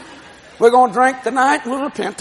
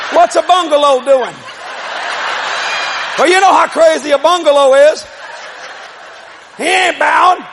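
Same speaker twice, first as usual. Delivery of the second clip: full-force preaching; 5 semitones higher than usual. Acted. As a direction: preach it to the rafters.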